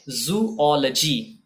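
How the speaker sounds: "जूलॉजी" slowly as zoo-O-luh-jee; teacher's careful zoo-O-luh-jee for जूलॉजी; correct pronunciation. Shown as a demonstration. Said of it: "correct pronunciation होता है जूलॉजी".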